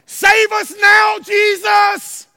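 slow, high, full-voiced shout; crowd shouting. Preacher voices crowd greeting Jesus, shouting. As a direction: belt it out word by word, pleading for rescue. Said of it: "They were shouting".

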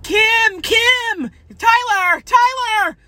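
very high shouted name-calling; scene: imitating students screaming her name, very high; mock calling out. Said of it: "I was like".

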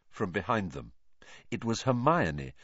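ordinary delivery

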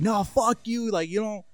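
high, defiant retort without volume; mock a worked-up classmate talking back; imitated indignant outburst; retold angry yelling. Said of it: "yelling at everybody".

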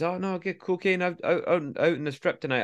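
high, wheedling dealer's insistence; persistent hustler. Retold or imitated. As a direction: keep pushing like a dodgy salesman. Dealer keeps pushing cocaine.

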